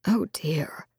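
soft unvoiced whisper; hushed oh dear. Friend reacting to her betrothal, in a whisper. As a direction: breathe it out in a whisper, taken aback.

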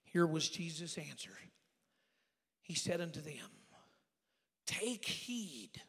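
measured, slowed reading of the verse; solemn scripture reading. Acted. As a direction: read the Bible words deliberately.

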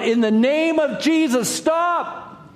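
pleading shout from a lone monk; crying out. Acted. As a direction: cry out over a roaring crowd. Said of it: "he cries out".

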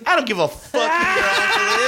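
high, laughing mock yell at the rich guy; imitating the guard's outburst through laughter; a hammed-up furious bouncer; mock shout. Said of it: "He goes".

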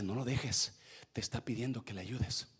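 very low, hushed urging; voice of God. Acted. God telling someone not to leave, hushed low voice. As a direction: counsel softly, low and quiet. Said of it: "Y Dios le dice".

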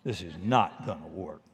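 low, quiet grumble under the breath; dismissive, half under the breath; muttered aside. Pretend. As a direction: mutter it while turning away. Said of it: "turn and look the other way and say".

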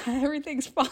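high, loud mock-cheerful cover-up; faking being fine. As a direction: act as if you are not about to cry. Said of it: "you have to try and be like".

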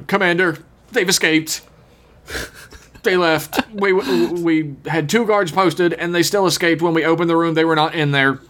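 stiff, wooden soldier report; overly earnest bad acting; bad actor voice. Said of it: "the worst actor who's ever been in Star Trek".

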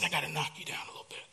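fast whisper, mostly breath; a secret whispered down from heaven; hushed voice of God. Acted. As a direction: lean in and whisper God's words. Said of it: "God said".

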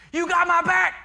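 high, loud rallying shout; hyped-up yell. Retold or imitated. Quarterback to huddled teammates at the game, hyped-up yell. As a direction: yell it like a quarterback pumping up the team. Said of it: "he's yelling, he's hyped up".

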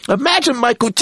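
mock stunned surprise; stunned what. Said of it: "So I'm like".

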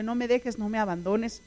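normal talking voice

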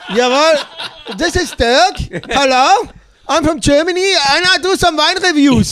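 hammed-up German wine critic, high and loud; Dirk the German reviewer. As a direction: announce yourself proudly in a jokey German persona. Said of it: "Dirk the Anus".

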